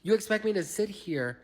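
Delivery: high-pitched, exasperated rant; indignant disbelief. Retold or imitated. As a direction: complain in theatrical outrage. Indignant reaction to 3 AM serenade.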